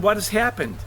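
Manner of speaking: ordinary talk, nothing marked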